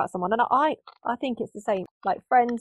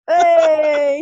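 shouted greeting; 6 semitones higher, 10 dB louder than usual. Acted. Greeting in the jingle voice.

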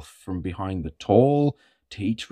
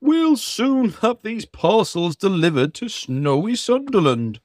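Santa voice; 9 semitones higher than usual. Acted. Santa speaks.

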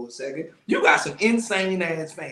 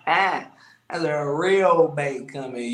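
slow punchline; 2 semitones lower, much slower than usual. Acted. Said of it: "repeats punchline slowly".

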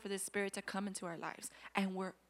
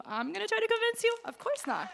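much higher, fast, then loud dismissal; incredulous rise snapping into a loud go; mock incredulous; 9 semitones higher, 4 dB louder, faster than usual. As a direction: scoff then send her off. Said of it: "And I go".

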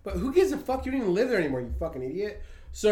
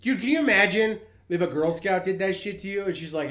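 normal talking voice

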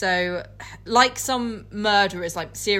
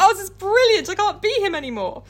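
much higher, fast gleeful voice; mock delighted Jekyll; 11 semitones higher, 6 dB louder, faster than usual. Friend voicing Jekyll's glee, high and fast. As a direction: squeal with relief like a giddy fool. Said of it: "he's just like".

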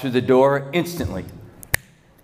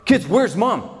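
high-pitched anxious question; urgent questioning; 9 semitones higher, slower than usual. Acted. Father asking crying kids about their mother, urgent. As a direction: press the children for an answer. Father notices wife is missing.

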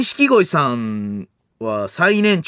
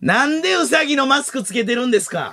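high-pitched comic retort; hammed-up complaint on air; mock host protest; 10 semitones higher than usual. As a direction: protest like an exasperated TV emcee. Plays host questioning rabbit mask.

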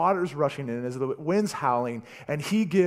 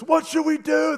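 high, loud yelled question; yelling; 14 semitones higher, 7 dB louder than usual. Acted. Sailors yelling to each other in the storm, loud and high. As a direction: yell it over the wind and waves. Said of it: "And they're yelling this".

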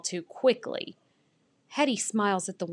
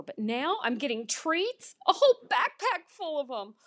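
excited; 6 semitones higher than usual. A narrator's reading. Dog thrilled about getting treats.

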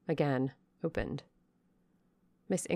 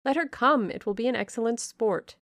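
eager; 6 semitones higher, 5 dB louder than usual. Acted.

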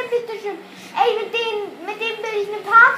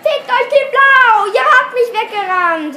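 loud, higher furious cursing; 1 angry shout; 4 semitones higher, 8 dB louder than usual. Real, not acted. Gamer cursing the opponents, loud angry shout. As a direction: yell it at the other team.

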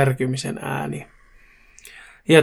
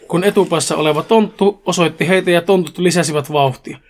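plain reading voice

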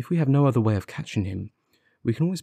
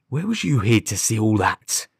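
louder, suspicious outburst; startled cry; 5 dB louder than usual. Acted. Frightened constable challenging the detective who knows too much, startled cry. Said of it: "he cried".

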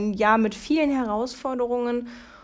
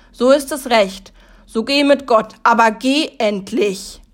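dramatic rhyming recital, louder; poem recital; 5 dB louder than usual. Acted. Reciting the Corona Christmas poem to viewers: dramatic declaiming.

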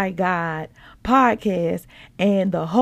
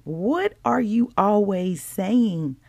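ordinary delivery

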